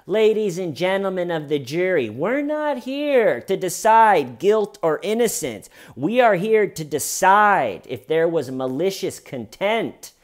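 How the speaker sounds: high, loud, grandiose courtroom address; theatrical closing-argument voice; mock defense lawyer. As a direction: plead grandly to the jury, hammed up. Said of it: "that's what the lawyer would argue".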